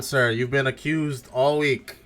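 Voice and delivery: ordinary talk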